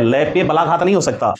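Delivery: ordinary talk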